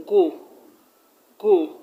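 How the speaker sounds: teacher singling out the syllable "go"; stressed syllable isolated. Shown as a demonstration. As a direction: hit "go" firmly as a separate beat. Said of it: "the third syllable from the end".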